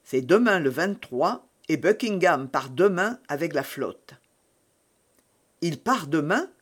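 plain reading voice

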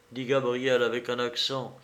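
usual speaking voice

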